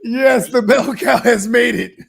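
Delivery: slow, loud laughing cheer. Real, not acted. Celebrating friend on TV.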